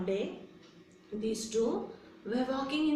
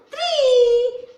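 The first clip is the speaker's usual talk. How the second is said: high, loud drawn-out call; race countdown shout; 13 semitones higher, 11 dB louder than usual. Acted. Teacher starts the race with children, high excited shout. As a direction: shoot up high on the final count.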